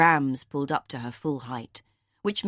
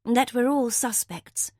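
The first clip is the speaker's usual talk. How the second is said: half sarcastic; 6 semitones higher, slower than usual. A narrator's reading. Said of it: "half in sarcasm, half in fear".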